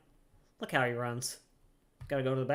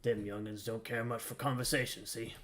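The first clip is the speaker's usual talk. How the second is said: a lightly voiced gruff old deckhand, chatty; grizzled sailor voice; 5 semitones lower, 10 dB quieter than usual. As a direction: read it as a weathered old seaman, barely shifted. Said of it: "Stay with the grizzled voice".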